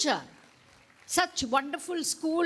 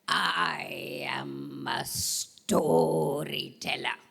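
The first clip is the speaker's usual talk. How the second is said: lower, very slow grand voice; deep storyteller voice; 8 semitones lower, much slower than usual. Shown as a demonstration. Teacher showing the class a deep, slow storyteller voice. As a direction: drag it out low and grand. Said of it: "Which voice?".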